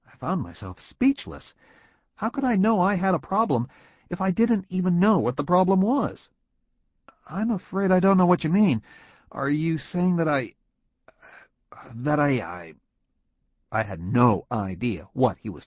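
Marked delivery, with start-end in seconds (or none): 2.1-12.7 much higher, rattled and stumbling; flustered. A narrator's reading. Employee confused and flustered before his boss. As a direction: let the words trip over themselves.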